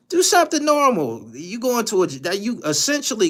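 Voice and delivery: high, loud exasperated rant. Real, not acted. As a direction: shout at him to get a grip. Ranting loudly at the player.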